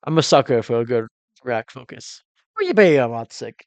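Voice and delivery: usual speaking voice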